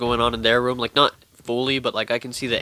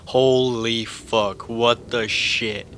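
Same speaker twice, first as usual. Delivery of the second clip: slow, stretched mock-frightened exclaim; a hammed-up scared holy fuck; spooked drawn-out gasp; much slower than usual. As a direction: drag it out like you just got creeped out. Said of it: "and we're all".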